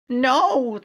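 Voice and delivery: very high, loud "no"; cried. Acted. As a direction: scream it in horror. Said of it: "Twilit cried".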